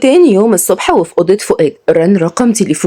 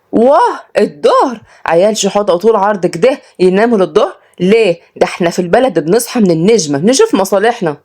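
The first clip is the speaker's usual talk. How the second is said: scandalised village woman; 2 semitones higher than usual. Acted. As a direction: tut at lazy city boys like a village woman. Voices Salma, scandalised. (صعيدية مستنكرة ومصدومة)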